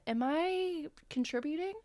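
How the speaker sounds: high, tentative mock worry; self-questioning. Voices own guilty question as viewer.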